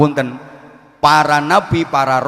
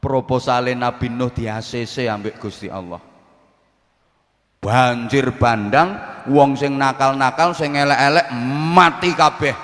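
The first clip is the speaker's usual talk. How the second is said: ordinary delivery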